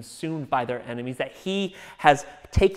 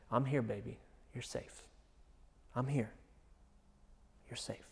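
soft, hushed reassurance; calm her back to sleep softly; soothing whisper; 13 dB quieter than usual. Retold or imitated. Parent hushing a frightened daughter, soft and tender.

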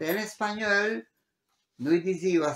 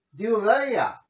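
mock exclamation; 6 semitones higher, 5 dB louder than usual. Acted exclamation.

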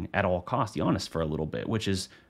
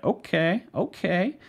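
high, rising, intrigued double okay; impressed fan reaction; 11 semitones higher, slower than usual. Acted. Fan watching a player heat up, pleasantly surprised. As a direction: voice a fan perking up, pitch lifted and rising.

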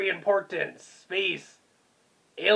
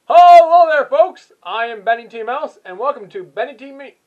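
booming game show host greeting; Benny T Mouse; 5 semitones higher, 14 dB louder than usual. Pretend. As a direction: open huge and high like a show host.

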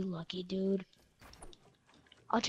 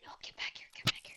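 hushed whisper to a teammate; 8 dB quieter, faster than usual. Real, not acted. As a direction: whisper so the enemy can't hear. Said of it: "Why are you whispering?".

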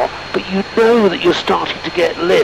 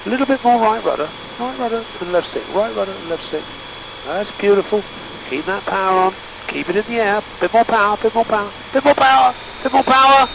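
urgent instructions; 7 semitones higher than usual. Real, not acted. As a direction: press the student, more and more urgent. Urgent flying instructions in cockpit.

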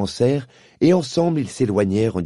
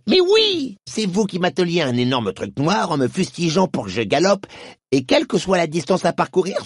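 high horse voice dawning recognition; Strawberry remembering; 7 semitones higher than usual. A narrator's reading. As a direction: piece the memory together aloud.

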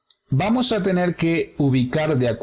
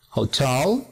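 "hotel" stressed on TEL; second-syllable stress. Shown as a demonstration. Teacher reading out examples, "hotel" accented on second syllable. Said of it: "hotel en la segunda".

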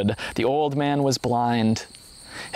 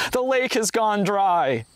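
high, slow cry of dismay; storyteller announcing a disaster in a raised pitch; narrated yell; 7 semitones higher, slower than usual. A narrator's reading. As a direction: exclaim it aghast, without full volume. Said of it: "yelled the first man".

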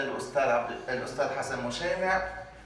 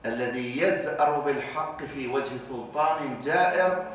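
ordinary talk, nothing marked

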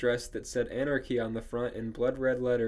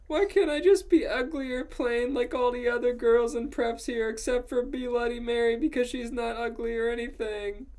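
high-pitched, whiny character voice; whiny protest; 11 semitones higher, 3 dB louder than usual. A narrator's reading. Ebony complaining about being too pretty, high whine. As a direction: whine it much higher than narration. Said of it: "I yielded".

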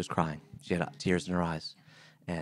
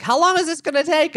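impatient quote; 11 dB louder than usual. Retold or imitated. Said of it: "your passion for Three Books when I got here because you were like".

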